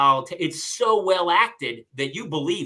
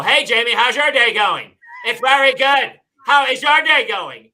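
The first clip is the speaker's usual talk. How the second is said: loud, higher, performed small talk; really loud; 6 semitones higher, 10 dB louder than usual. Retold or imitated. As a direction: fake a loud chat to annoy him. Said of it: "start talking to myself really loud".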